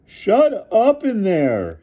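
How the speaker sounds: high, slow, loud coach voice; bellowed. A narrator's reading. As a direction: bellow from the window. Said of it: "he bellowed".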